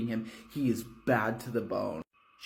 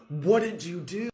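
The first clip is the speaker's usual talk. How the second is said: held-back yell; 6 semitones higher, 4 dB louder than usual. Acted. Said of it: "I can't yell here 'cause I'm in a hotel".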